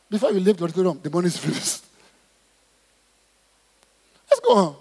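ordinary talk, nothing marked

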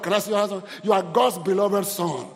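ordinary talk, nothing marked

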